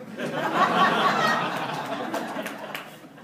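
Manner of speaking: loud breathy burst of laughter; big laugh. Real, not acted. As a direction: let out a full laugh. Laughs at his own joke.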